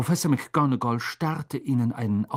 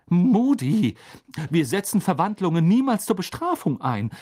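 high-pitched exasperated reproach; agitated, raised-pitch scolding; McGonagall, worn out; 8 semitones higher, 5 dB louder than usual. A narrator's reading. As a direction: plead higher, as if at wit's end. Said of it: "sagte Professor McGonagall ermattet".

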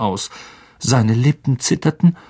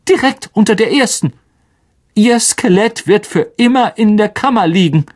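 raised, tense stern professor; shaken McGonagall; 7 semitones higher, 3 dB louder than usual. A narrator's reading. McGonagall reporting the heir's message to the staff, tense and raised. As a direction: pitch it up, voice strained with shock.

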